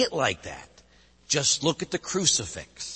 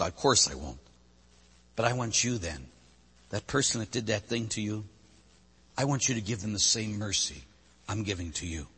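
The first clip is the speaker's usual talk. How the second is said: gentle reply; 5 semitones lower, 7 dB quieter than usual. Acted. God answering the sinner gently.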